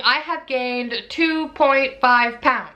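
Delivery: slow, drawn-out reveal of the number; slow announcement. Real, not acted. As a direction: stretch out two point five pounds. Announcing weight gain.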